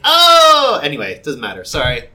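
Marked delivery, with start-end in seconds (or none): 0.0-0.8 surprised shout. Retold or imitated. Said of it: "And he's like".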